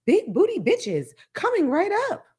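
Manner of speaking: Siri voice. Retold or imitated. Mocking how Siri answers a kid, high assistant voice. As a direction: play a cheerful phone assistant. Voicing Siri's reply.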